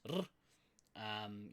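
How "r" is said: R as a short rolled trill, not the letter name. Shown as a demonstration. Teacher showing how to roll your R's, a trilled R. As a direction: trill the R on the tongue. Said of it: "roll your R's".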